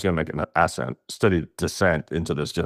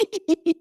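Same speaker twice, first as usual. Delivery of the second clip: high giggle. Retold or imitated. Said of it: "Sammy's just like".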